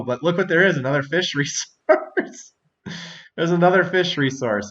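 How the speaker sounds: high, loud surprise cracking into laughter; amused surprise. Real, not acted. Player finding another fish resource, amused and surprised. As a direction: exclaim it, laughing partway through.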